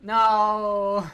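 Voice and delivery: loud shout of no. Real, not acted. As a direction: yell it out. Getting hit in fight.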